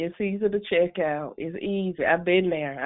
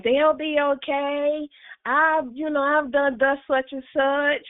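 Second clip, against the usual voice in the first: higher-pitched, mock-reassuring excuse; dismissive self-excuse; 8 semitones higher than usual. Parent excusing own inattention to children, higher brush-off. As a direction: wave it off in a raised, drawn-out pitch.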